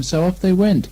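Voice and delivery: ordinary delivery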